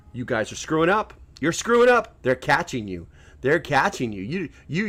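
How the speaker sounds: usual speaking voice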